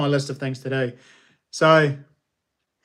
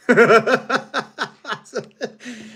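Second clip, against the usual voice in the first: loud, high burst of laughter; delighted laugh; 8 semitones higher, 5 dB louder than usual. Real, not acted. Streamer laughs aloud at the viewer's uncanny guess. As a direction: laugh out in amazement.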